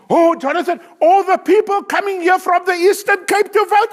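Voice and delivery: quoting relative. Retold or imitated. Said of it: "Jonathan is gonna explode".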